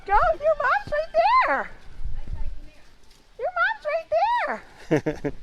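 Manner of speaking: high, sweet coaxing voice; soothing a child. Acted. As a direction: coax gently like to a toddler. Guiding lamb to mother.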